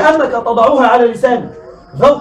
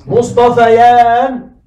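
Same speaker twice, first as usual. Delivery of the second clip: مصطفيان stretched very slowly, louder; drawn-out demo; 5 dB louder, much slower than usual. Shown as a demonstration. Teacher drawing out مصطفيان for students, very slowly and louder. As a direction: spell out مصطفيان syllable by syllable. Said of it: "الألف تقلب ياء".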